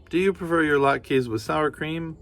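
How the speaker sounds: a hint of a cheerful granny; kindly grandmother. Baba Brena thanking the bear, lightly voiced granny.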